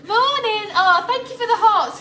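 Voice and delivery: high, loud bright hello; excited greeting. Real, not acted. Greeting live viewers, high and loud with excitement. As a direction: burst in with a big hello.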